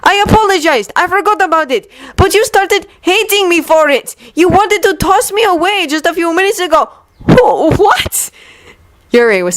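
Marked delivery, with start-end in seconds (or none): 0.0-6.9 high, raised wounded voice; hurt rant. Acted. Victor accuses Yuri of hating him, hurt and angry. 7.3-8.3 high stammered disbelief; shocked what. Acted. Yuri, stunned by Victor's words, stammers.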